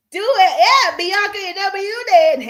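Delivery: loud, high hype; excited shout-out. Real, not acted. Host greeting Bianca in the building, excited shout-out. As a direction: cheer the newcomer in. Hyping a viewer who arrives.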